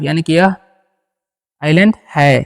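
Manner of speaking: ordinary delivery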